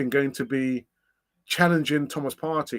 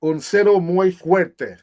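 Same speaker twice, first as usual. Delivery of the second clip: slow, loud South American Spanish accent; 3 semitones higher, 7 dB louder, slower than usual. Shown as a demonstration. Reading a chat request, in a South American accent. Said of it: "Zero in a South American accent".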